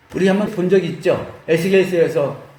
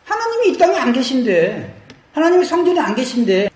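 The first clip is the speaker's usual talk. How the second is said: higher-pitched acted grievance; mock defiant; 6 semitones higher than usual. Priests talking back to God about His absence, mock aggrieved. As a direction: talk back with a complaint. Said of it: "이렇게 대드는 마음을".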